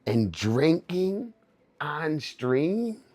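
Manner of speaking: slow, high scolding-mom voice; mock a scandalized mother; imitated mom. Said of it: "She goes".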